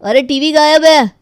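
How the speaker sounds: loud, high alarmed call; voiced boy calling out in alarm. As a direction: shout it across the house. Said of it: "तभी गौरव आवाज़ लगाता है".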